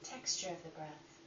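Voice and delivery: calm, unhurried guiding voice with long pauses; guided meditation. Acted. Teacher guiding listeners through breath inquiry, calm meditation voice. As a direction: lead them inward one gentle question at a time.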